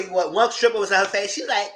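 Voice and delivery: usual speaking voice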